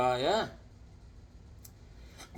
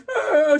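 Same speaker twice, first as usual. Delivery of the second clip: put-on high, loud kid's cry; caught-out child's wail, played up; mock wail; 11 semitones higher, 5 dB louder than usual. Crying kid spotted by mom outside, mock wail.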